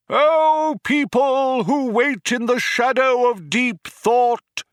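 high, loud, slow proclamation; crying out to crowd. A narrator's reading. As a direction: boom it out like a rally speaker. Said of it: "he cried out".

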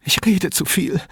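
high, anxious self-reproach; distressed. A narrator's reading. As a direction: catch yourself rambling, still upset. Distraught caller checks himself. (aufgelöst, mit hoher Stimme)

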